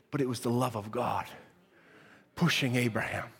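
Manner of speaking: soft, low, intimate sermon; hushed preaching. Acted. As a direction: draw them in quietly. Preacher drops into hush.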